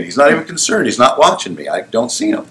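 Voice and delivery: ordinary talk, nothing marked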